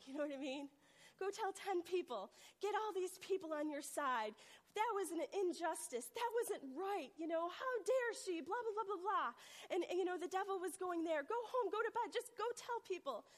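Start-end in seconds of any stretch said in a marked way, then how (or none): 1.2-9.3 raised, higher mock outrage; imitated righteous indignation, building higher; indignant egging-on. Acts out the devil egging her on. 11.2-13.2 high, raised urging voice; imitated pushy egging-on; insistent tempter. Devil's urging acted out.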